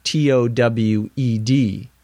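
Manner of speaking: T O W E D, each letter said apart; spelling out towed. Shown as a demonstration. Teacher spelling the word towed for learners, letter by letter. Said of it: "my car may be towed".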